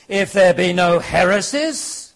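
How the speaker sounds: loud, high royal bellow; king's roar. Acted. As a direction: roar it out slowly like a commanding king. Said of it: "roared the king".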